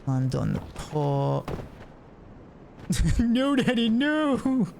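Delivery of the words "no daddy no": much higher, slow whining plea; pleading child. Acted. Child begging Daddy, high and drawn out. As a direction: whine like a scared little kid.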